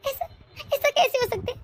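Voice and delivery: crying. Acted. सास बहू पर इल्ज़ाम से टूटती.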